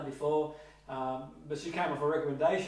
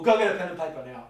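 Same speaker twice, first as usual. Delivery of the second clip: quick, louder order; firm command; 5 dB louder, much faster than usual. Shown as a demonstration. Teacher's second version of the order, firm. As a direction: fire off the instruction. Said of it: "let's say, for example".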